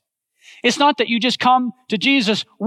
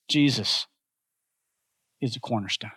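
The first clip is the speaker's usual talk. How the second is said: soft statement; 8 semitones lower, 7 dB quieter than usual. Acted. Key line stated softly.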